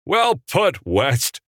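high-pitched gleeful approval; chortled. A narrator's reading. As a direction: crow it with delight. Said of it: "Capra chortled".

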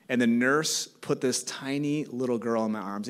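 normal talking voice

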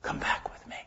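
breathy, almost silent echo; fading whisper. Acted. Preacher echoes God's call as a whisper. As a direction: let the plea die to a breath.

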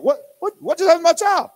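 high, loud, stunned parent crying out; shocked disbelief. Retold or imitated. Grandpa stunned by his granddaughter's sudden change, loud and high. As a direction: throw it up high like a baffled grandpa.

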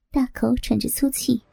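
ordinary delivery, nothing marked

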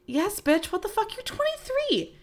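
high-pitched mock outrage, way up in the voice; incredulous comeback. Telling young cousin at the bar, high mock outrage. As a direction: squeal it like it's obvious. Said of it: "And I'm like".

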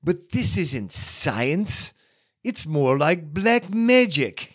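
slow, high clipped British movie line; imitating a classic British film accent. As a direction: do Colin Clive's British accent, drawn out. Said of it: "classic British accent's like".

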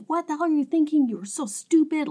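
a hint of the husband's fury, rushed out; imitating a yelling husband. Retelling how the hero screams at her, mock angry. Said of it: "He's just screaming".

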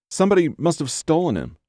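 high, hurried, tearful distress; verge of tears. A narrator's reading. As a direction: rush it out near tears. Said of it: "Her voice trembled on the verge of tears".

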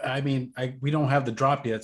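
ordinary talk

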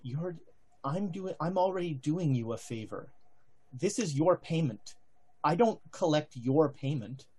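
hushed voice carried on the wind; breathy, distant whisper; gusting windy whisper. Acted. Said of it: "you hear over the wind a voice saying".

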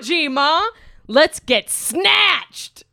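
hammed-up hype host, big yeehaw squeal up top; wacky announcer hamming up a podcast opener; over-the-top podcast host intro. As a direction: play a goofy fantasy podcast host firing up the crowd. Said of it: "Should I do a funny one?".

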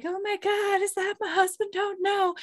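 high-pitched, hammed-up dismay; mock bridal alarm. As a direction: squeak it like a startled bride. Retelling bride seeing giant groom.

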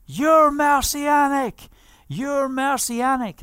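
high, slow, jeering yell of the accusation; mocking scream. Anti-missionaries chasing the preacher, shouting a high mocking yell. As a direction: hurl it like a street heckler. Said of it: "screaming me down in the streets".

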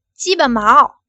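said out loud, forced; loud, forced answer. Acted. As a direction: blurt it loudly as ordered. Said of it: "大声点儿".

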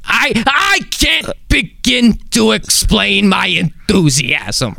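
high, slow, squawking crazy voice; Gilbert Gottfried. Acted. Radio producer recreating the impression done before the comedian, high squawk. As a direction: strain it up high and drag out every word. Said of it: "working on an impression".

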